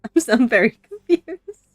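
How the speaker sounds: loud laughter through words; cracking up while confused; laughing. Real, not acted. Laughing at confusing game scene.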